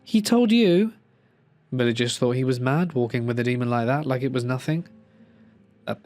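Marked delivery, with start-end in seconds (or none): 0.0-0.9 high, loud burst of surprise; mother's astonished high-pitched question; surprised mum. A narrator's reading. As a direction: exclaim it high in amused disbelief. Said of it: "she laughed in surprise".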